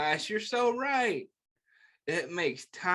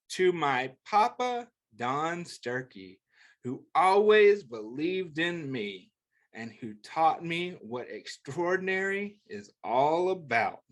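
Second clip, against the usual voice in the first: gentle picture book read-aloud; reading to children; 3 semitones lower than usual. Acted. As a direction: read it like a bedtime book for kids.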